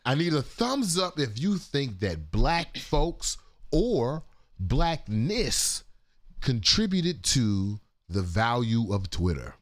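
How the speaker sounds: slow, emphatic sarcastic poll question. Shown as a demonstration. Host asking for thumbs up on Twitter, sarcastic. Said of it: "can y'all see the sarcasm".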